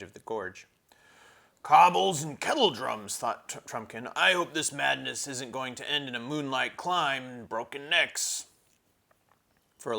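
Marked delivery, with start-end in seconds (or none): 1.6-3.2 grumbling dwarf. Acted. Trumpkin urging the children on, loud grumpy dwarf. 4.2-8.5 grumbling dwarf. Acted. Trumpkin urging the children on, loud grumpy dwarf.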